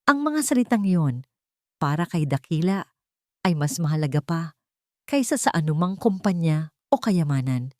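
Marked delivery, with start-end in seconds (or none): none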